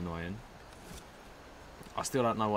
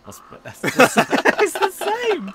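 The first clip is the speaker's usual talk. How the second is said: laughing; 13 dB louder than usual. Real, not acted. Gamer cracks up at his friend's joke, laughing. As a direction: laugh it out in disbelief.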